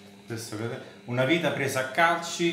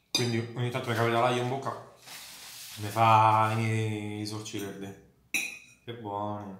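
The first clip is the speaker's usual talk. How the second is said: speaking with food in mouth; mid-meal talking with full mouth; talking while chewing; slower than usual. Real, not acted. Seen 3: bite taken at -1.0, speaks with food, touches mouth.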